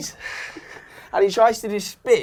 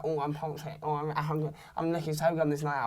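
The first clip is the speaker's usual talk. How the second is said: mock girl's thoughts; 2 semitones lower, 13 dB quieter than usual. Mocking girl's inner thoughts.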